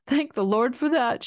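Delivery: a touch of crying through thanks; tearful. A narrator's reading. Wife bursting into relieved tears as husband returns. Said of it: "brushed her tears away".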